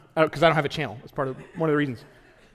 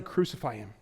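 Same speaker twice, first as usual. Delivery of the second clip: quiet, hushed yell; 8 semitones lower, 10 dB quieter than usual. Retold or imitated. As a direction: lower and softer, from Jesus' point of view. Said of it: "they are yelling".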